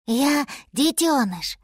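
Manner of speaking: lightly voiced small mammoth; gentle drawn-out child answer; baby mammoth.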